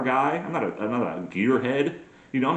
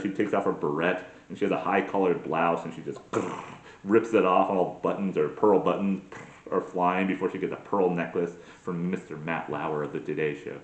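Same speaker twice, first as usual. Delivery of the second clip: ordinary talk, nothing marked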